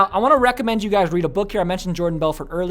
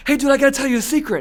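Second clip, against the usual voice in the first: much higher and louder, called across the room; calling out; 9 semitones higher, 5 dB louder, faster than usual. Retold or imitated. Kid calling a secret across the room, loud. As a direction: announce the secret from ten feet away. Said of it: "from ten feet away".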